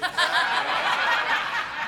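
breathy high laugh at his own joke. Real, not acted. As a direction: burst into a high giggle.

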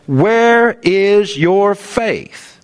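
very slow, drawn-out challenge; Jesus's rebuking question. Acted. Preacher saying it like Jesus to the disciples, slow and emphatic. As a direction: stretch out each word like a solemn rebuke. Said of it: "say it like Jesus said it".

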